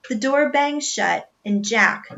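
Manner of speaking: ordinary delivery, nothing marked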